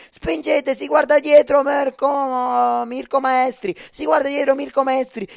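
loud, high, urging them on; excited cheering. Real, not acted. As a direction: shout the riders home. Urging riders on in the finale.

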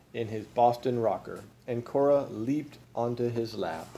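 ordinary delivery

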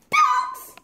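high, loud yell of the word. Acted. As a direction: shout it up high. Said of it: "yelled Michael".

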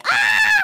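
high, loud scream; sudden high yelp of alarm. Acted. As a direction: cry out as the car hits. Car crash in the game.

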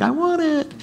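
high, pleading "I want it"; childish wanting voice. Pretend. Heart pleading to buy something, high childish whine. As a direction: whine it like a greedy inner child. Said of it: "your heart was like".

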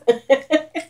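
light high giggle; quick happy laugh. Real, not acted. Laugh after greeting.

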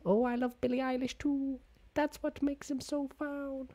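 high-pitched mocking voice; squeaky put-on fan voice; mock TikToker. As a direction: imitate a gushing TikTok poster way up high. Mocking people who film TikToks.

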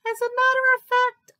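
high, loud cub voice; small voice full of pride; proud little tiger. A narrator's reading. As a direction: announce it importantly, pitched high. Little Tiger answers brother proudly.

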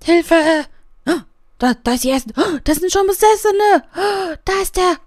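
high, loud and frantic; excited squeaking. Real, not acted. Streamer panics as possessed Sims appear, high and loud. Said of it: "wie so ein Bekloppter wieder rumquietsche".

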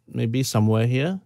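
ordinary talk

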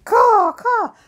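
high, loud crow squawks; noisy crows. Pretend. Crows always talking to each other, noisy squawking. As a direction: caw like chattering birds. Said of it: "I think they're talking to me".

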